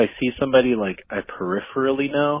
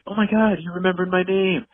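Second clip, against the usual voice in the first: high, thrilled gasp of a reply; flattered squeal; delighted surprise; 8 semitones higher than usual. Retold or imitated. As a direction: gush that they were remembered. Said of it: "they're like".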